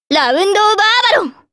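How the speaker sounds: high, fast call of a special move; triumphant battle invocation; climactic attack cry. Acted. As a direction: pitch soaring through the move's name. Climactic special attack name called.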